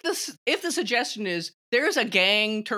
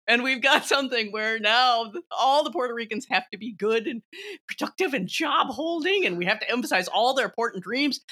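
ordinary delivery

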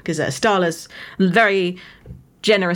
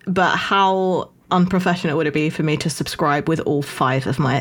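ordinary delivery

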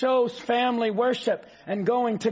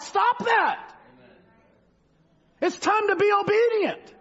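stern rebuke; 10 semitones higher than usual. Real, not acted. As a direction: cut the doubt off hard, like a scolding preacher. Preacher rebukes doubting reasoning.

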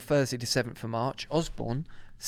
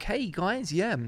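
posh radio presenter voice; 6 semitones higher, slower than usual. Retold or imitated. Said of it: "she's trying to go like".

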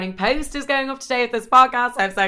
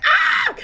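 sudden shrieking yelp, very high and loud; high-pitched scream; 6 dB louder than usual. Retold or imitated. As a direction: let out a startled high scream. Acting out being hit with fright.

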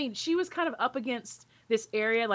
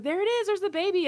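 excited; 12 semitones higher than usual. Retold or imitated. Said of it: "we were like".

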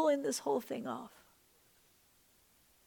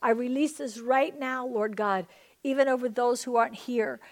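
ordinary delivery

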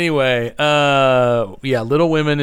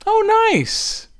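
high oh nice. Real, not acted. Reacting to breakfast.

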